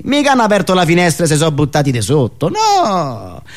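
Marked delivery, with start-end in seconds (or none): none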